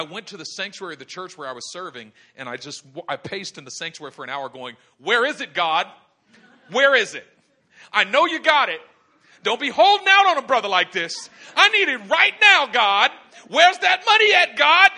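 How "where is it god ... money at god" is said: loud, high shouted prayer; impatient, hammed-up pleading at full voice; desperate demand. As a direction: yell at God, building in pitch and volume.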